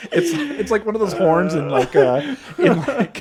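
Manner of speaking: high, loud, breaking up with laughter; laughing hard. Real, not acted. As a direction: struggle to talk through the laughs. Cracking up at failed robot noise.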